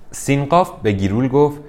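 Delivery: usual speaking voice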